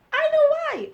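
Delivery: very high, loud squeal; loud high-pitched interjection; giddy outburst. Real, not acted. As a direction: squeal it out, far above normal pitch. Loud comic aside after laughing.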